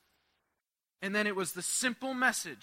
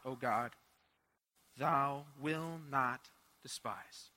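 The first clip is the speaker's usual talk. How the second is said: scripture reading; 3 semitones lower than usual. Acted. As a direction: read the psalm like a prayer. Reading a psalm aloud.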